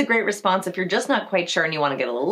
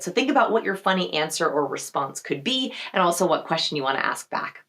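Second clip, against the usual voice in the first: usual speaking voice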